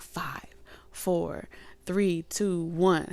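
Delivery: whispered countdown, hushed. Acted. Spirit guide counting down to launch, whispered. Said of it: "this person is like".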